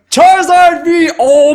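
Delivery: drawn-out excited yell at a big pull; excited shout. Real, not acted. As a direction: hype shout, stretched out. Pulls a Charizard card.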